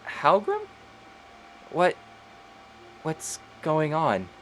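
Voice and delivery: put-on confused revived girl asks her friend; waking confused. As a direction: ask as if just waking from death. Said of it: "and she just says".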